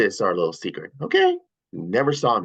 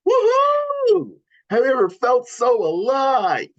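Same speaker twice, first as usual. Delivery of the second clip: excited whoop; 10 semitones higher, slower than usual. Acted. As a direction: whoop with pure thrill. Sonic thrilled running fast.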